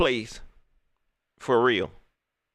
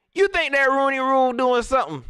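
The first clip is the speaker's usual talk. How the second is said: incredulous; 10 semitones higher, 5 dB louder than usual. Real, not acted. Laughing disbelief at Rooney Rule.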